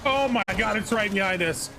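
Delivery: high alarmed voice; alarmed, something is right behind; being chased. Real, not acted. Monster right behind them.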